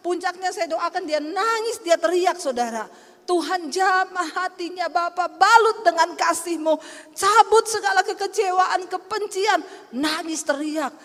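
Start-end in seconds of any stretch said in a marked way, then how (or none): 3.2-9.6 high, driving retold prayer; fervent prayer. Pastor praying over a hurt woman, fervent. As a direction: plead to God with rising force.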